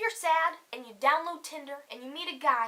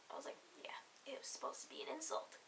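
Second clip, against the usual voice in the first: whispered aside; 18 dB quieter, faster than usual. Real, not acted.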